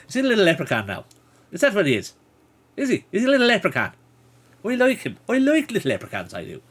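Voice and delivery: high, playful Irish leprechaun talk; Irish accent. Pretend. Streamer fussing over a little leprechaun, high Irish accent. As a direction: coo high-pitched in a put-on Irish accent. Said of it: "I can do a sort of Irish accent".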